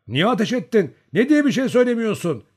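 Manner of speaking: high, loud badgering; loud, impatient demand; insistent questioning. A narrator's reading. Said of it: "diye üstelediler".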